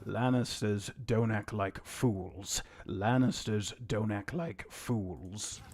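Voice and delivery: slow, measured Tywin imitation; mimicking Tywin. Shown as a demonstration. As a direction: drag each word like a cold lord. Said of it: "let me listen to him".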